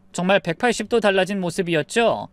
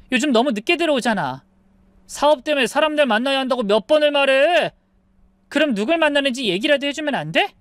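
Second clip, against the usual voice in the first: higher, insistent wife pressing back; heated pleading; 6 semitones higher than usual. Acted. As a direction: push back, voice rising in frustration. 부부 말다툼 중 아내. (목소리를 높여 따지는 아내)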